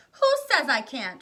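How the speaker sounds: high, loud, slow defiance; defiant shout. A narrator's reading. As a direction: hurl it back defiantly. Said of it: "shouted Veruca".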